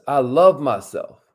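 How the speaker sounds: affirmation reading. Shown as a demonstration. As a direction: lead them, word by word. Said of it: "Say it with us".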